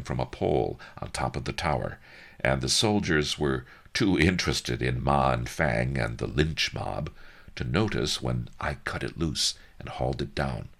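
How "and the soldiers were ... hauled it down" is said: plain reading voice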